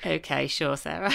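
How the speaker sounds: usual speaking voice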